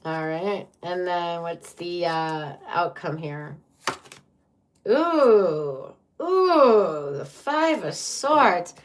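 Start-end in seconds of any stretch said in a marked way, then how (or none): 4.9-8.7 high, loud, drawn-out groan of dismay; dismayed reaction. Real, not acted. Tarot reader turns up a card they hate, dismayed. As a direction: recoil loudly, voice swooping up.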